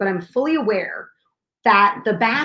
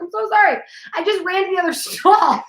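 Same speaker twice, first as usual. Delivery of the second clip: higher, through laughter; retelling her apology, giggling; laughing apology; 6 semitones higher than usual. Retold or imitated. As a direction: laugh it out sheepishly.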